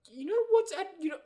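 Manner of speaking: mocking fan voice. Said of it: "the more people are like".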